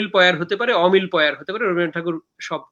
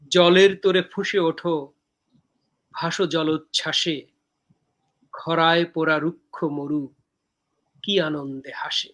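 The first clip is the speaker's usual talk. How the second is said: poem recitation. Acted. Poet reciting own poem from Dehokabyo to viewers, measured recitation.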